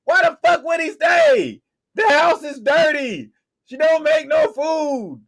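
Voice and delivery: high, loud ranting voice; heated rant. Real, not acted. Podcast host rants to his cohost about Dame, loud and high.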